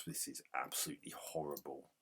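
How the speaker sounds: normal talking voice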